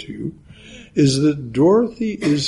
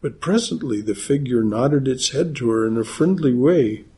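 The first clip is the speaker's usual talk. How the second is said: normal talking voice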